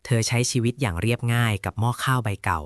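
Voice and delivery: plain reading voice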